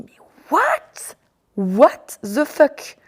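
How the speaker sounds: a very high, incredulous what, then a slow drawn-out what the fuck; shocked, can't believe this is happening; stunned disbelief. Retold or imitated. She reacts in disbelief to the furious dad.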